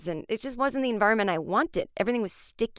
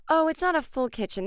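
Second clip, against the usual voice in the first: chirpy landlady; 9 semitones higher than usual. Retold or imitated. Quoting the landlady.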